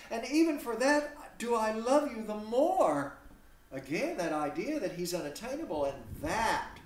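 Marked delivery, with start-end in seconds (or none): 0.1-3.2 higher and louder, clinging to her love; devoted Hermia. Acted. Hermia answering Demetrius's rejection, higher and louder. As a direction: insist she loves him all the more. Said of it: "look what she says in return".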